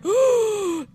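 sharp, high, loud gasp; shocked gasp. Retold or imitated. Seeing the table land on Kane, a loud shocked gasp. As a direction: gasp as if a man just died. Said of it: "I'm like".

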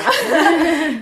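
high, loud laugh; sudden real laugh; burst of laughter. Real, not acted. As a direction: crack up at the joke. Laughing at the [name] joke.

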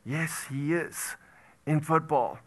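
normal talking voice

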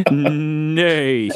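put-on horse neigh in a German accent. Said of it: "I tried to do that in a German accent".

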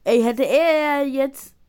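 loud, slow outcry. Real, not acted. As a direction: cry out in frustration. Frustrated gaming moment.

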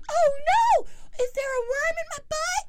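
put-on high, loud frightened child voice; scared little kid. Frightened son asking his parents about the worm, panicky. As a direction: cry out like a panicked six-year-old. Six-year-old voiced in story.

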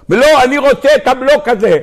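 mock protest, pitched high; stubborn insistence. Block owner refuses money for stolen block, stubborn mock protest.

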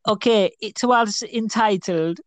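high-pitched mock Jamaican accent reading a long title. Scene: reading an absurdly long book title in a mock Jamaican accent.

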